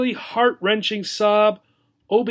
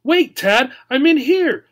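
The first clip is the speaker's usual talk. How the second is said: higher, louder call; 8 semitones higher, 6 dB louder than usual. Acted. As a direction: call after him. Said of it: "Obi called out".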